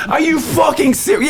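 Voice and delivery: high, shouted disbelief; outraged disbelief. Retold or imitated. Refused customer shouting at the window girls.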